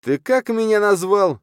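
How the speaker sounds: very high, loud, slow demand; outraged bark. A narrator's reading. Editor insulted by the khan's offer, barking. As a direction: shout it in offended disbelief. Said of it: "рявкнул Щенко".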